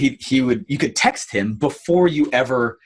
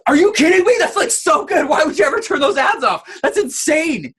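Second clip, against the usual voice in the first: incredulous investor; 13 semitones higher than usual. Retold or imitated. VC guy hearing ads were turned off, incredulous. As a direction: act outraged disbelief. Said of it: "And the guy was like".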